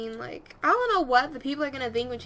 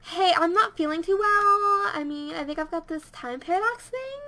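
attempted character voice, raised and slowed; Spencer; 4 semitones higher, 4 dB louder than usual. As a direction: start high and loud, then let it slip. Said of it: "I can't do Spencer's voice anymore".

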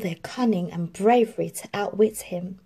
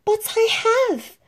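high, louder protest; protesting Cinderella; 5 dB louder than usual. A narrator's reading. Cinderella protests to her stepmother, high. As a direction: plead your case. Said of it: "protested Cinderella".

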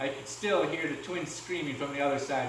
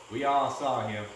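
plain reading voice

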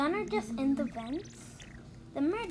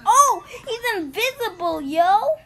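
sudden loud surprise; surprised; 5 semitones higher, 7 dB louder than usual. Real, not acted. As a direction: blurt it out in shock. Surprised at invisible player.

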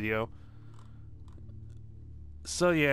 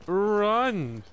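high, drawn-out holler; hammed-up high cry; playful urgent call; 8 semitones higher, 4 dB louder than usual. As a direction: call out a mock order to run. Calling out during game.

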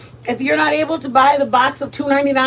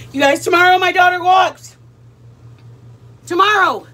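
proud, louder, higher exclaim; excited announcement; 5 semitones higher, 5 dB louder than usual. Real, not acted. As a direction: burst out with proud news. Proud news about daughter.

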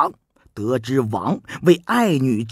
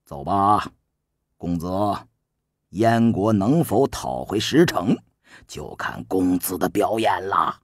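lower, measured scheming adviser; a shrewd minister calmly coaching, voiced low and soft; Su Qin's voice; 10 semitones lower, 4 dB quieter than usual. Su Qin calmly instructs the young prince, low and soft.

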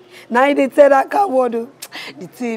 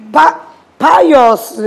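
loud, raised call that breaks off and restarts; frantic calling; 5 semitones higher, 8 dB louder than usual. Acted. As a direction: call out urgently. Calling out, no answer.

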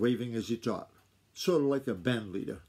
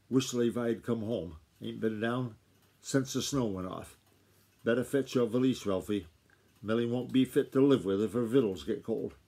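ordinary delivery

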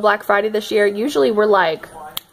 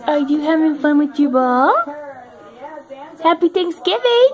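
higher pet-talk voice; talking to dog; 4 semitones higher than usual. Real, not acted. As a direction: coo at the dog.

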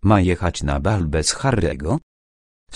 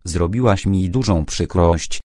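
ordinary delivery

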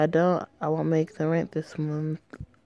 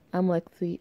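ordinary talk, nothing marked

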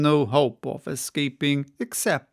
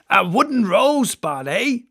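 louder, higher mocking cry; cried out; 5 semitones higher, 6 dB louder than usual. Stubb jeers at the ship's figurehead, crying out. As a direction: call it out, amused. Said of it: "he cried".

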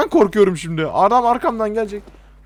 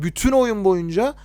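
ordinary delivery

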